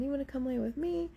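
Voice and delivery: lightly voiced child inviting you over; little girl's invite. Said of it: "the other girl's, like, asking my cousin".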